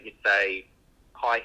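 ordinary talk, nothing marked

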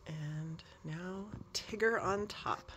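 slow hushed whisper. Real, not acted. Vlogger putting Tigger on the top of the stack, in a slow whisper. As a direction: breathe it out slowly as you place it. Placing last toy.

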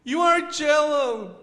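high, loud little-bird voice; slow, pleading baby bird; Choco. Acted. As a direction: cry out high and drawn-out as a lonely chick. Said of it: "How about you be the voice of Choco?".

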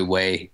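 Wei said like the English word way; Mandarin pronunciation. Shown as a demonstration. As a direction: pronounce Wei the Mandarin way, not as the letter Y. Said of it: "if it's standard Mandarin Chinese ... it's probably Wei".

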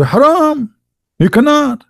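high, sing-song "haram" mocking a preacher's ban; mock cleric. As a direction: parrot the strict ruling in a high, sneering voice.

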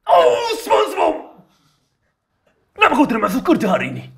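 high, loud scolding cartoon voice; hammed-up squeaky scolding; cartoon boss voice. Playing Squidward/boss to SpongeBob.

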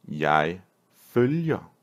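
long pause after "Jeg", then "følger"; slow teaching take. Shown as a demonstration. Teacher modelling a Danish verb for learners, slowly word by word.

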